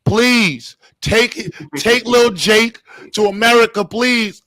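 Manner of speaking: loud, high, hammed-up begging; pleading Irish father. Begging sir to take his son, loud mock-desperate plea. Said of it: "I don't know how to do your accent".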